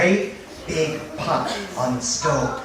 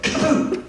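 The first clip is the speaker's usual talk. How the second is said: explosive cartoon launch sound; sudden high sound-effect pop; popping-out noise; 11 semitones higher than usual. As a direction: burst it out like a pea shooting free. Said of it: "and a pea went".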